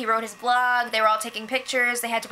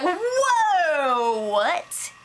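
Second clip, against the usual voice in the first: higher, drawn-out whoa; retold indignant disbelief; 4 semitones higher than usual. Day reacting to the rumor accusation, in mock indignant disbelief. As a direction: imitate an outraged, drawn-out reaction. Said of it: "she is pissed".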